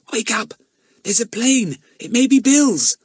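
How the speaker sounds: put-on high, excited boy rousing someone; urgent excitement. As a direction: wake them with thrilled urgency. Jack wakes his sister, plane spotted.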